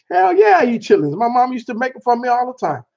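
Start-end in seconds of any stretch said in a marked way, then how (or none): 0.1-1.2 high, enthusiastic hell yeah; proud and happy about chitlins; eager yes. Real, not acted. Answering if he eats chitlins, high and eager.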